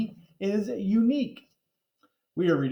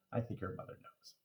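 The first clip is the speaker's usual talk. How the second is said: dry whispered remark; whispered aside; 7 semitones lower, 15 dB quieter, faster than usual. Acted.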